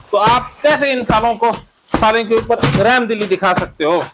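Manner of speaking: high-pitched, relentless egging-on; fired-up therapy drill leader; heated cathartic incitement. Acted. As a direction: goad them to attack the imagined enemy. Incites group to show no mercy.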